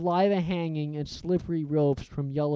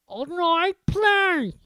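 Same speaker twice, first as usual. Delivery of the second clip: Filch; 13 semitones higher, 4 dB louder, much slower than usual. A narrator's reading. Filch forced to ask.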